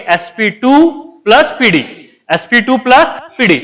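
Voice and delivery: "SP टू" stretched out, then "plus पी डी" set apart; split, deliberate reading. Shown as a demonstration. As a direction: dictate it in two chunks, lingering on "टू". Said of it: "आप आइंदा से ऐसे पढ़ेंगे".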